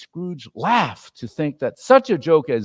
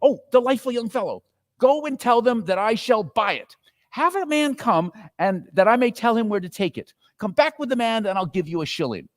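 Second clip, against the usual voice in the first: high, quick delighted orders; gleeful old man bargaining; excited Scrooge; 10 semitones higher, 4 dB louder than usual. A narrator's reading. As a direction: rush the boy off gleefully. Scrooge gives eager orders.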